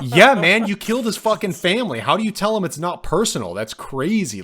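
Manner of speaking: high, loud protest easing off; incredulous outburst. Acted. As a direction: call him out in amazed disbelief. Mock reply to the cartel boss.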